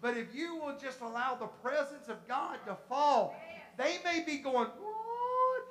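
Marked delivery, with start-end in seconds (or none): 4.7-5.7 very high, drawn-out sound of amazement; mock bewildered wonder; astonished onlooker. Preacher mimicking people who may not understand, amazed.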